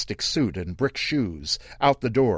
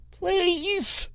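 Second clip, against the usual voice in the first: very high, small pleading squeak; young boy pleading; squeaky boy's voice. Acted. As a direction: beg like a frightened little boy. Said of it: "a squeaky boy's voice".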